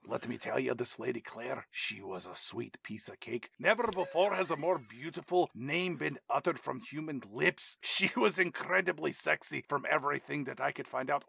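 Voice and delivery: put-on Gerard Butler's Scottish accent. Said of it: "Legitimate Scottish accents happening".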